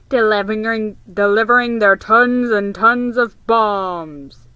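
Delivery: boy imitating a radio announcer; mock radio announcer. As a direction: boom it like a wartime broadcaster, mockingly. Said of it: "imitated the deep voice of radio announcers".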